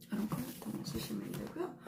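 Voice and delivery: soft whisper, ending on a rise; whispered repeat. Shown as a demonstration. Teacher repeats the color tip to viewers, whispered. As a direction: breathe the tip again as a quiet aside. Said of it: "이런 컬러 톤을 쓰시면 되세요".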